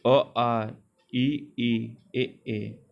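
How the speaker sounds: अ, आ, ई, ए with spread lips, drawn out; unrounded vowel demo. Shown as a demonstration. Teacher showing students unrounded vowels, drawn out. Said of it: "अवृत्तमुखी बोलते हैं उसको".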